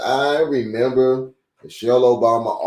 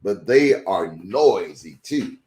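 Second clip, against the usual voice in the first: steady, clear read-aloud voice; reading to children; 5 semitones higher than usual. A narrator's reading. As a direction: read it like a picture book for kids. Reads a children's book aloud.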